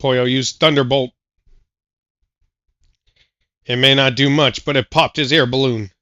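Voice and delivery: play-by-play announcer. Acted. Announcer calling the battle.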